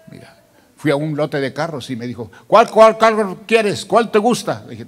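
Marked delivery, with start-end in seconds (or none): 2.5-4.6 loud, high and eager offer; big, high-pitched sales question; eager car dealer. Retold or imitated. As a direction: imitate a car seller pushing a choice.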